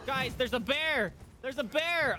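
high, loud and slow warning shout; excited alarm. Real, not acted. Player warns the other guys about a bear, shouting. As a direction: yell the warning to everyone.

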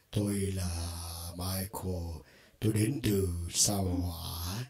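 slow, processed alien voice; a slow, warped spaceman voice; voice effect demo. Shown as a demonstration. Teacher demos a voice effect to viewers, slow and processed. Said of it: "Đó là các effect khác nhau".

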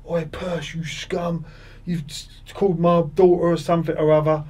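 high, mock-furious yelling from the touchline; angry heckler. Angry fan on the touchline accusing the player, high mock yell. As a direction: bark it at him like a riled-up fan.

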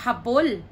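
"Habol" as ha-BOL, falling; stress on last syllable. Shown as a demonstration. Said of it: "So the stress is here".

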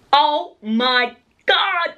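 high, very slow gasp of astonishment; amazed. Retold or imitated. Pushing mom sees mad hair, amazed. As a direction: drag out each word in awe.

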